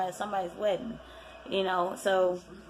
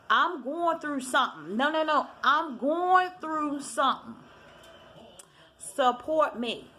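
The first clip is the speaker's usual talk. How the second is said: pleading Nene imitation; 6 semitones higher than usual. Nene's plea imitated.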